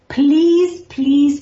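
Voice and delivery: pleading. Retold or imitated. Father begging his son in a dream to say Kaddish, pleading loudly. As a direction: beg him, voice lifted and urgent. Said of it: "was pleading with him".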